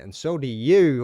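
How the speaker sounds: retelling a cheeky comeback to a stranger; slow pointed retort. Retold or imitated.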